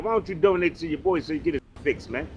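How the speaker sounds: ordinary talk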